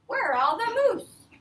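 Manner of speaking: high, loud call up to the goose. Acted. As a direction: call up into the tree. Girl calls to goose.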